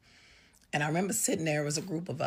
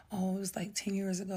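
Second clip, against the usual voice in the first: softer, slightly raised retold reply; hushed and matter-of-fact; subdued answer; 4 semitones higher, 4 dB quieter than usual. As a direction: imitate a quiet, offhand answer. Parents answer offhand, retold.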